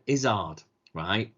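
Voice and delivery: ordinary talk, nothing marked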